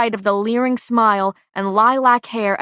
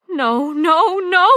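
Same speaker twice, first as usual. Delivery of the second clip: higher, louder sobbing denial; 9 semitones higher, 7 dB louder, slower than usual. A narrator's reading. Pei sobs as her friend says she's dying. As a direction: cry it out through sobs. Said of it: "My words are a sob".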